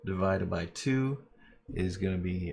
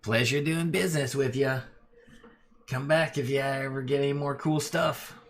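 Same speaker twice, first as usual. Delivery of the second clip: chummy goblins sending the party off; goblin voices; 6 semitones higher than usual. Acted. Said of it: "both goblins say at the same time".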